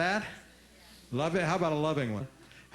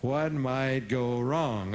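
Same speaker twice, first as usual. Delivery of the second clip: slow, drawn out; much slower than usual. Shown as a demonstration. Audience repeating the question after the speaker, slowly. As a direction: spell out "What might go wrong?" slowly and deliberately. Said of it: "Let's hear it".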